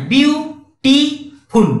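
ବିୟୁଟିଫୁଲ୍ very slowly, "byuu ... tii ... phul"; ବିୟୁଟିଫୁଲ୍ pulled apart for counting; exaggerated syllable split. Shown as a demonstration. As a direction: leave clear gaps between all three syllables of ବିୟୁଟିଫୁଲ୍. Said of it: "ଦୁଇଟାରୁ ଅଧିକା ହଉଛି".

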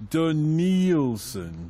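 DANIELSON stretched out very slowly; slow, spelled-out pun. Shown as a demonstration. Host repeats Danielson so co-hosts get it, drawn out. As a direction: draw out Danielson syllable by syllable.

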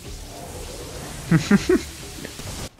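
high, voiceless chuckle; real breathy giggle; chuckling. Real, not acted. Laughs during play.

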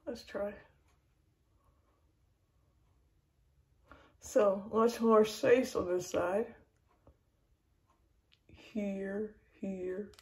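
lips pulled back, teeth bared; speaking around dental pick; talking with tool in mouth. Real, not acted. Seen 2: Thin tool held in mouth corner, lips pulled back over braces.

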